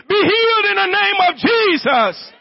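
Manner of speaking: high, forceful preacher's cry; commanding healing declaration. Real, not acted. Preacher commanding the sick to be healed, high forceful declaration. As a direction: proclaim it with full authority.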